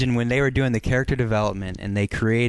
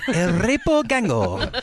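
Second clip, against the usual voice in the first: mock Spanish; 7 semitones higher, slower than usual. Joking mock Spanish phrase.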